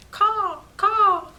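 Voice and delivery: loud high cawing crow. Acted. Reader voices birds.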